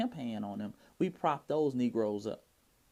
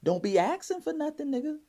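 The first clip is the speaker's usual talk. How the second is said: pimp laying down rules; 6 semitones higher, 3 dB louder than usual. Acted. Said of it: "he's talking to his hoes right now".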